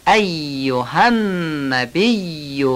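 ایها النبی with the doubled ی and ن held long; slow, pausing on every doubled consonant in ایها النبی; slow ایها النبی with the doubled consonants lingered on. Shown as a demonstration. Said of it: "هر سه تا رو با مکس بگید".

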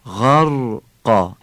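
slow غرقا, soft stretched "gh", firm "q"; "غرقا" as drawn-out "gharr", then abrupt "qaa"; soft ghayn, hard qaf. Shown as a demonstration. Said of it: "غین رو باید نرم بگیم". («غرقا» با غینِ نرم و قافِ سفت)

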